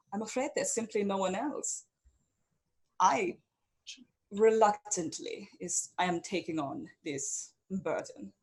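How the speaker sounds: lightly voiced reluctant noblewoman; lady leader's proclamation. A narrator's reading. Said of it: "And she says".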